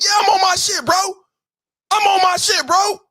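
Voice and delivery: pumped up brag; hyped boast. Real, not acted. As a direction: yell it out proud. Boasting after praise.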